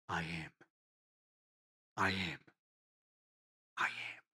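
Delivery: low, soft, reverent I am; hushed reverent words. Retold or imitated. Preacher voicing Jesus saying the holy name, hushed. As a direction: breathe the holy name with awe.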